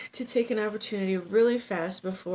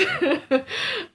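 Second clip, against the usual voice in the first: high laugh; 13 semitones higher, 6 dB louder than usual. Real, not acted. Laughs after own pitch.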